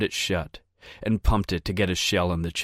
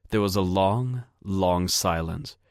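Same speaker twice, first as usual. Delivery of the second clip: ordinary delivery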